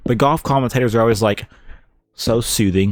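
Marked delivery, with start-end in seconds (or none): none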